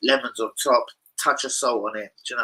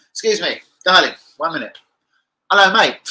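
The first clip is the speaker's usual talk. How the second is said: higher, punchy mock street greeting; hailing strangers; 4 semitones higher, 8 dB louder than usual. Pranksters stopping randoms in the street, mock hailing. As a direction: play at stopping passers-by, voice raised.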